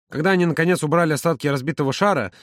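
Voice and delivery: plain reading voice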